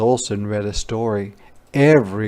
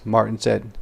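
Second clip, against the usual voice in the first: plain reading voice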